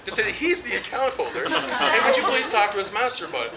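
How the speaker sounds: ordinary talk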